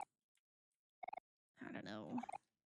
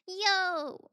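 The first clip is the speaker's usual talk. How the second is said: loud, high yo; excited yo; 5 semitones higher, 9 dB louder than usual. Real, not acted. Streamer loudly greeting a viewer with a high yo. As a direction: call out a happy greeting.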